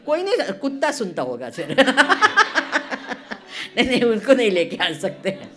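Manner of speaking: quick, laughing through the words; laughing banter; laughing joke. Real, not acted. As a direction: crack up at your own joke. Joke about dog, laughing.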